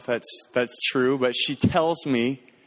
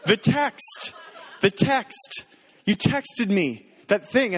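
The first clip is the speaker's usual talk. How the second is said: imitating fiancée; 8 semitones higher, much slower than usual. Fiancée's quote retold.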